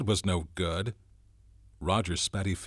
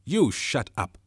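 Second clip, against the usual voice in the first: loud, higher snap with "You" hit hard; fierce; 5 semitones higher, 5 dB louder than usual. A narrator's reading. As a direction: shut him down fiercely. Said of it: "Jack turned fiercely".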